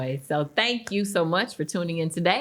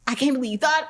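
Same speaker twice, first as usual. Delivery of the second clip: higher-pitched, touched amazement; excited disbelief; 7 semitones higher, much faster than usual. Retold or imitated. Host retelling guest's reaction to the invitation, mildly excited disbelief. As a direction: imitate someone thrilled to be asked. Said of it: "it was just your excitement".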